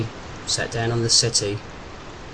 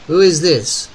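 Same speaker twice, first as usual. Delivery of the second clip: phone operator demands a name, raised, louder challenge; 5 semitones higher, 7 dB louder, slower than usual. A narrator's reading. Unknown voice answering Derek's call, challenging him. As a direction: challenge the caller, higher and louder.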